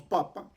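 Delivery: papa with short A sounds. Shown as a demonstration. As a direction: keep both A's in "papa" brief. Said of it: "que son cortas".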